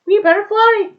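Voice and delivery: high, loud panicky suggestion; startled. Acted. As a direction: blurt it as a startled quail. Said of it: "she was startled over again".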